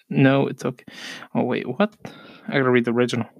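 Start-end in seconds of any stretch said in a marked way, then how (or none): none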